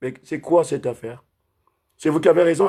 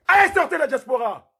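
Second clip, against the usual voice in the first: angry outburst; 10 semitones higher than usual. Real, not acted.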